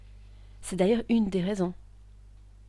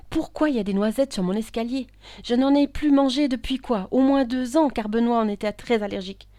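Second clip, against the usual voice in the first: loud, raised, agitated questioning; indignant bafflement; 5 semitones higher, 8 dB louder than usual. Acted. Woman finding hazelnuts on her stairs, indignant. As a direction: demand an explanation of the impossible.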